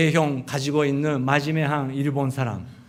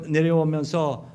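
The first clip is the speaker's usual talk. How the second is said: normal talking voice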